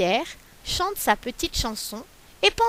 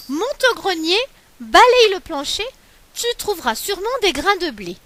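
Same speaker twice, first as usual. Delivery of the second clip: higher and louder, bossing her up to the attic; an old man calling out orders, quick at the end; old man sending his wife upstairs, loud and bossy; old man ordering; 7 semitones higher, 5 dB louder than usual. Acted.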